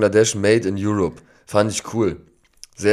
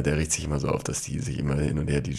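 usual speaking voice

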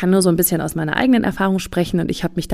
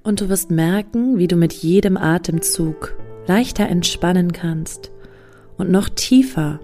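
guided meditation; slower than usual. Acted. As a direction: guide them into calm, slowly. (ruhige Meditationsstimme)

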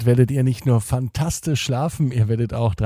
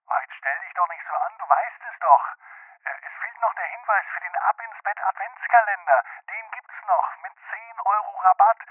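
other Marco voice; 6 semitones higher than usual. Acted. Second Marco voice, sketch.

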